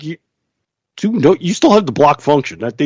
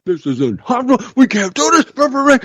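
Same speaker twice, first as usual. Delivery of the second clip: much higher, mocking overreaction; mock panic; 12 semitones higher than usual. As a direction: parody the outraged users' meltdown.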